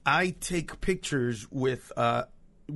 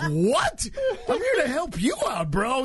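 higher, offended disbelief at the friend; mock surprised protest; 8 semitones higher than usual. Comic telling a friend he came to help, surprised and higher. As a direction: act baffled he'd call you out. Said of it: "I'm like".

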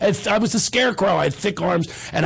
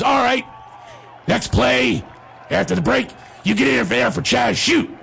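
coach shout; 5 semitones higher than usual. Retold or imitated. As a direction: call it out like a coach. Coach calls substitution.

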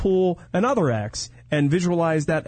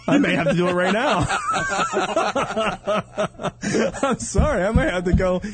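laughing while talking; 4 semitones higher than usual. Real, not acted. Laughing at the joke.

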